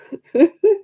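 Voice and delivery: high laugh. Real, not acted. Laughs at own story.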